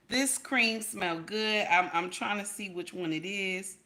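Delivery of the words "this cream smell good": savoring. Real, not acted. Savoring scent.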